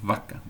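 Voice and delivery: low, soft vaca with buzzing v; demo v sound. Shown as a demonstration. Teacher shows a voiced v for the class. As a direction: lip on teeth, voiced v in vaca.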